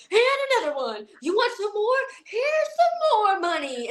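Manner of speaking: high excited yell handing out cash; excited hype shout. Retold or imitated. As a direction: pump the kid up with a shout. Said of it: "I'm hearing Ryan go".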